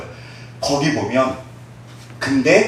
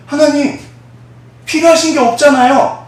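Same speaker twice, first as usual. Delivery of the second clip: high, loud startled protest; dumbfounded; 12 semitones higher, 5 dB louder than usual. Retold or imitated. The deacon stunned by God's question, high and startled. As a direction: blurt it out, stunned. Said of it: "너 벙쪄가지고".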